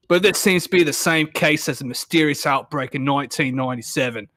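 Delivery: hammed-up British newsreader drifting Australian; mock British accent. Reader voicing a BBC special report, wobbly British-Australian accent. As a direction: do a bad British accent, reporting grave news. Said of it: "You made him Australian as fuck".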